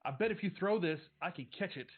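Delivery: put-on chirpy little-boy challenge; eager kid. Little brother dares Noah to throw, eager. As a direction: dare him to throw it. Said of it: "he goes".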